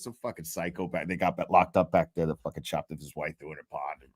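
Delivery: a hint of a deadpan courtroom lawyer, dropped low; Reddington impression. Imitating the lawyer telling the streamer about a case, low deadpan. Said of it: "he goes".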